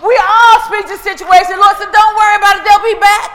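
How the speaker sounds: mounting pulpit shout; full-force preaching. Real, not acted. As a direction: thunder it out to the congregation.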